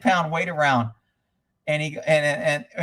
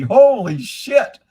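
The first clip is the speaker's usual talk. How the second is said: amazed; 7 semitones higher, 9 dB louder, much slower than usual. Real, not acted. Reacting to chat whiskey comment.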